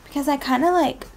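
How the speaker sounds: normal talking voice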